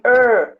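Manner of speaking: "er" with a curled-back countryside Brazilian R; slow, drawn-out "er" like an interior caipira R. Shown as a demonstration. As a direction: hold "er" on a retroflex R. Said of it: "é meio caipira".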